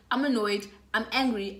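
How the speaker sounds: ordinary delivery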